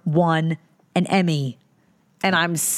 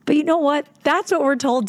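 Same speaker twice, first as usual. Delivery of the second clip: high whine; 10 semitones higher than usual. Real, not acted. Giving up playfully.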